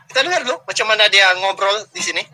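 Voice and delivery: ordinary talk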